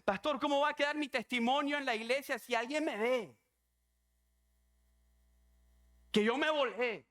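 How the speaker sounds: high-pitched worried questioning; imitated anxious plea. Scared woman fears church will see her, imitated anxiety. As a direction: fret aloud, voice pushed up. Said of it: "¿sabes qué decía?".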